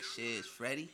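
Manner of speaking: low, hushed smooth introduction; low pickup-line murmur; sweet talking. Acted. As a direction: say it softly, playing suave. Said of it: "You be sweet talking to her".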